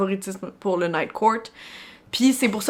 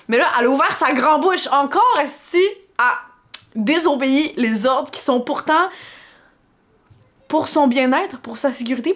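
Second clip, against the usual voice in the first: exasperated rant; 5 semitones higher, 6 dB louder than usual. Real, not acted. Frustrated rant about character.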